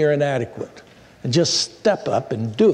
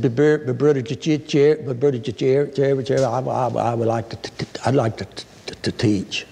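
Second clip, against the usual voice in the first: a hint of a shy stammer, lower; stuttering woman; 3 semitones lower than usual. Church member volunteering to teach, stuttering. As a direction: stammer through each word, halting. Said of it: "but boy, did she stutter".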